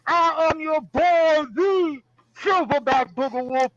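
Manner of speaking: booming ring announcer, drawn out. Acted. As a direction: hype the crowd like a wrestling emcee. Wrestling ring announcer intro.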